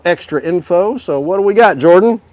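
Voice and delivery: normal talking voice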